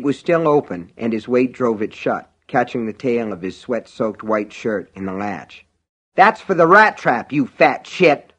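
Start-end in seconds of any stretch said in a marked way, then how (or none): 6.1-8.3 vicious yell, slowing at the end; angry cry. Acted. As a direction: spit out the insult. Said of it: "Norris cried".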